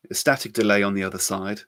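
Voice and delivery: usual speaking voice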